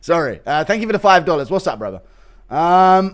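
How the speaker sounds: ordinary delivery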